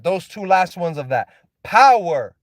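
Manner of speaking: ordinary talk, nothing marked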